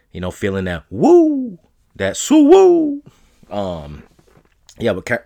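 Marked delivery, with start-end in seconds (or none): 2.0-3.0 whoop. Real, not acted. Whooping about being high.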